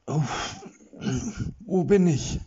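high, dazed voice under cloth; muffled voice. Acted. Erik trapped under a curtain, muffled and dazed. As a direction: call out confused from beneath fabric. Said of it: "fragte er mit gedämpfter Stimme".

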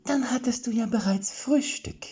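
a squeaky villain calling in from the side; high falsetto voice. A narrator's reading. Chica Vollbart mocks the waking group in a high falsetto. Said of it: "sagte eine hohe Fistelstimme vom Seiteneingang".

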